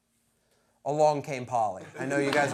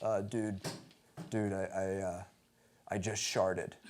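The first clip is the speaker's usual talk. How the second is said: 1 nervous slob; 10 semitones lower, 10 dB quieter than usual. Retold or imitated. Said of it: "he says".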